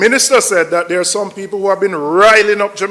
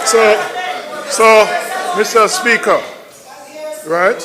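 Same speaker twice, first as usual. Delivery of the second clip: raised call; 2 semitones higher than usual. Real, not acted. Member shouting to the Speaker over the chamber's noise. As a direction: call out to take back the floor. Regaining floor amid noise.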